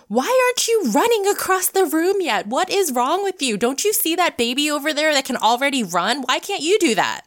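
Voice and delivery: put-on high, loud, incredulous scolding of a baby; mock scolding parent. Imagined parent scolding a crawling baby, high and loud. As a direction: play the impatient parent berating a toddler. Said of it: "no parent looks at a baby who's learning to crawl and says".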